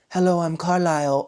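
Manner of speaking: a lightly voiced suave British vampire doctor; slightly British vampire. Doctor introducing himself to Bella, a touch of British accent. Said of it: "All the vampires talk like this, a little bit British".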